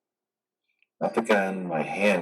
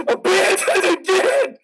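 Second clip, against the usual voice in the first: furious yelling; 5 semitones higher than usual. Acted. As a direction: scream your outrage at the whole world. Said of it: "I'll yell if I want to".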